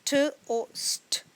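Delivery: स्ट said as one unvoiced cluster; sounding out a blend. Shown as a demonstration. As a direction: keep स्ट together as a blend. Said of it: "इसको हम इकट्ठा बोलेंगे".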